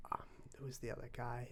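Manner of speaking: quick unvoiced whisper, half to himself; whispered aside. Real, not acted. Whispering an aside while naming VidCon guests. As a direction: murmur it fast under your breath.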